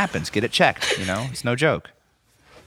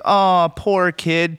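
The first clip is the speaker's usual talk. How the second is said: slow, drawn-out pity, higher pitched; mock sympathy; 4 semitones higher, much slower than usual. Cohost acting out viewers' reaction to the kid, drawn-out sympathy. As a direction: draw out a higher, pitying "oh". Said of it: "every emotion that you could have".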